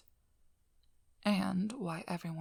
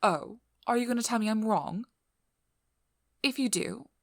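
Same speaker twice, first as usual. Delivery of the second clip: louder, confrontational challenge; challenging; 4 semitones higher, 5 dB louder than usual. Acted. Speaker daring the vampire to deny not feeding, challenging. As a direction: dare them to contradict you.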